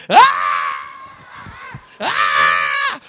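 loud, full-blown mock shriek; imitated scream. Preacher acting the woman too hurt to look up, loud scream. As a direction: scream over the preacher's question, twice. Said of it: "she's on the ground screaming".

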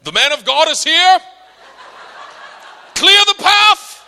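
loud, high hammed-up proclamation; grand bursting entrance. Preacher imagining bursting on the scene, booming. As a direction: announce yourself to the whole scene, slowing at the end. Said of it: "I burst on the scene".

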